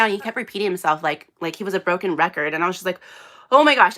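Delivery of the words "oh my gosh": higher, louder retold gasp of alarm; mildly acted alarm while retelling the night; alarmed oh my gosh. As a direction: relive the shock of finding him hurt. Retelling husband's scary fall.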